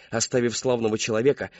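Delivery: usual speaking voice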